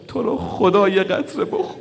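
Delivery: high, loud sobbing plea rising at the end; pleading cry. Acted. Father begging his dying son to drink, sobbing plea. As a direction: beg like a father at a deathbed.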